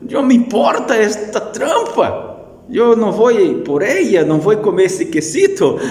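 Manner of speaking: high, loud mocking ram voice; the ram waves the mouse off with a laugh; dismissive ram. Preacher as the ram sending the mouse away, high and scornful. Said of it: "el carnero dice así".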